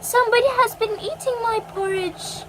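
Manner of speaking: high mama bear voice; lightly voiced mother bear, pitched well up; gentle Mother Bear. A narrator's reading. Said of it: "said in her quiet voice".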